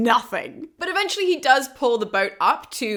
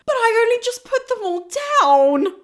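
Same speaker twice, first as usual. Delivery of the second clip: whiny Xanthias; 13 semitones higher, 5 dB louder than usual. Retold or imitated. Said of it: "Xanthias is like".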